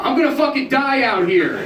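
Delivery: put-on high, loud histrionic drunk cry; drunk wail. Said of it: "shaking one of the managers going".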